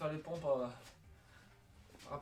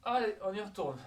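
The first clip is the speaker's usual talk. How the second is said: loud call; 6 semitones higher, 9 dB louder than usual. Real, not acted. Coach calling workout start.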